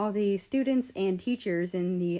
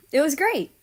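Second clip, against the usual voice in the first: higher, louder bright answer; cheerful; 8 semitones higher, 8 dB louder than usual. Acted. Canada telling mother school was great, cheerful. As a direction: answer with eager joy. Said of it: "Canada said".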